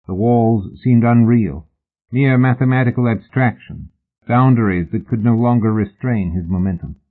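ordinary delivery, nothing marked

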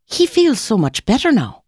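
put-on high, chirpy old lady; bright, chipper. A narrator's reading. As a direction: chirp it cheerfully, pitched well up. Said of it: "in a bright, chipper voice".

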